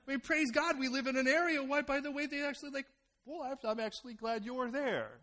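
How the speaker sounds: loud, high, fervent sermon delivery; loud fervent preacher; preaching at full force. Acted. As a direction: preach it out to the congregation.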